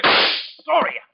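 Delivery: high, loud shouting mid-scuffle; struggling shout. Acted. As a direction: yell at her to stop fighting. Struggling with an armed woman.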